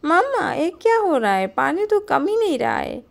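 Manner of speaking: high, loud cry to Mama; alarmed child. A narrator's reading. Child to mother as floodwater rises, high alarmed cry.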